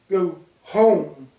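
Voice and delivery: home stretched and lifted, as if far away; emphasis on home. A narrator's reading. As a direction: lean on the word home, longing. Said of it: "She emphasized the word home".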